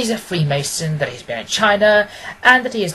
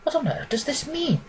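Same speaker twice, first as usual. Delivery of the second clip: high, startled blurt; a sudden outburst of bewilderment; surprised exclamation; 10 semitones higher, 7 dB quieter than usual. Acted. Watson reads the odd advertisement, startled. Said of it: "I ejaculated".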